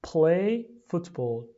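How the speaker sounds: very slow teacher model; deliberate classroom drill; slow model phrase. Shown as a demonstration. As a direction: draw the words out to copy. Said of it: "لطفاً تکرار کنید".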